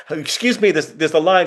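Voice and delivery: indignant queue complaint. Retold or imitated. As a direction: imitate people objecting to a queue-jumper. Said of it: "The people on the line are going".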